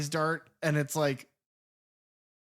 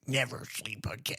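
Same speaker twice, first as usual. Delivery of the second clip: put-on creepy kid voice; 2 semitones lower, 6 dB quieter than usual. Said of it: "the kid is like".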